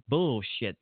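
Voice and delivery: ordinary talk, nothing marked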